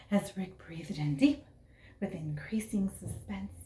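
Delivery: breathy hushed build-up; hushed storyteller suspense; suspenseful whisper. A narrator's reading.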